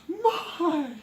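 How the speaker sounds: high surprised princess exclamation; a little startled cry, high. Acted.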